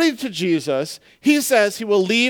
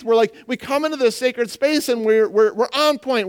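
ordinary delivery

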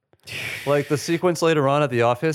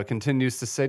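ordinary talk

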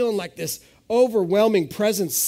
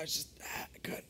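breathless unvoiced whisper, choked off; strangled whisper; 2 semitones lower, 19 dB quieter than usual. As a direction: gasp it out as if you can't speak. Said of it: "I couldn't even".

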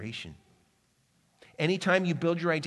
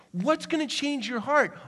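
much higher, What's gonna change your heart? lifted and pressing; raised urgent question; 8 semitones higher than usual. Real, not acted. Preacher repeats question, rising.

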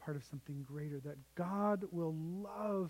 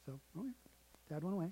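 usual speaking voice

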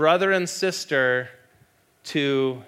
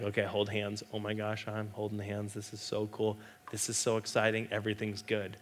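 hushed giddy thrill; 4 semitones lower, 8 dB quieter than usual. Retold or imitated. Teen voiced on first date.

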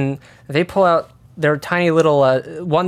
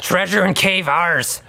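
slow, possessive goblin voice; slow goblin warning; 3 semitones higher, slower than usual. Pretend. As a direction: lay claim like a goblin, slowly.

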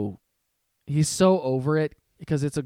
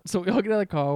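ordinary talk

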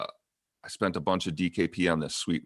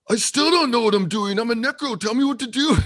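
high, loud, exasperated mock Scot; hammed-up clueless Scottish player; horrible Scottish accent; 14 semitones higher, 5 dB louder than usual. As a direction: wail it in a bad Scottish accent. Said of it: "horrible Scottish accent".

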